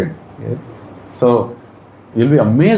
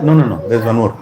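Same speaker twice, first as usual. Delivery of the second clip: usual speaking voice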